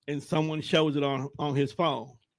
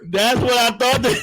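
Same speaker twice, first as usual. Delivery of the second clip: laughing; 8 semitones higher, 5 dB louder than usual. Real, not acted. Laughing at the joke.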